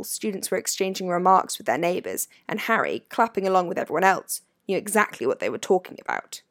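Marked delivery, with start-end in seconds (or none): none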